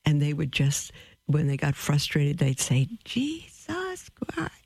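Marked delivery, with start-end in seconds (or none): 3.0-4.5 slow, airy whispered oath, not a real shout; retold curse. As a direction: breathe the curse out softly and slowly. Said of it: "shout his name out loud".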